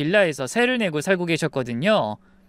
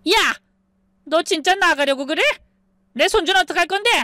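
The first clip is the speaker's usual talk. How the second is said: screeching mother-in-law; 11 semitones higher than usual. Acted. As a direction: yell high and shrill at the leaving daughter-in-law. Mother-in-law yells at her. (며느리에게 소리 지르는 시어머니)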